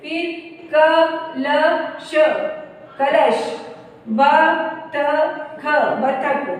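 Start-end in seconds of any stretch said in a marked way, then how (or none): 0.7-2.1 कलश very slowly, in three separate sounds; sounded out. Shown as a demonstration. Teacher showing children how to join कलश, letter by letter. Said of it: "इस तरह से जोड़ के पढ़ेंगे". 4.1-5.6 बतख drawn out as ब, त, ख; sounded out. Shown as a demonstration. Teacher showing children how to join बतख, letter by letter. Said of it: "इस तरह से जोड़ के पढ़ेंगे".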